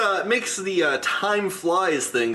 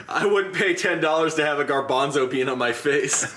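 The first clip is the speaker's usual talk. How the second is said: ordinary delivery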